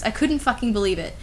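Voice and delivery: usual speaking voice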